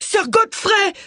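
very high-pitched scream of alarm, without extra volume; high, desperate call; screamed cry. A narrator's reading. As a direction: cry out his name in panic. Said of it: "hurla Agatha".

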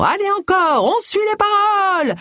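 very high-pitched yell to the crowd; high, sustained rallying holler. A narrator's reading. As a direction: whip up the audience from the stage. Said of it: "hurlait-il".

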